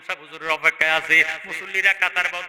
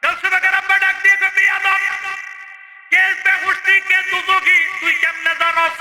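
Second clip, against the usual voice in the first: high, loud chanted rebuke; full-force preaching; 14 semitones higher, 6 dB louder than usual. Acted. Preacher voicing the father challenging his son, high chanted rebuke. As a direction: fire off the rebuke on one high, loud note.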